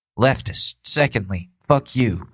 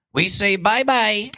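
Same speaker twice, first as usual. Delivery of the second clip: singsong bye-bye, pitched up; mock bye-bye; 10 semitones higher than usual. Comic mocking deportees with a cheery bye-bye. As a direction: wave them off in a childish singsong.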